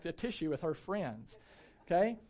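normal talking voice

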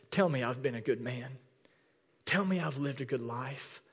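soft, understated imitation of crying; mock tearful plea. Old veteran asking his wife for reassurance, quietly imitated tearful plea. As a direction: plead quietly, only a touch of tears. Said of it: "he's crying, and says".

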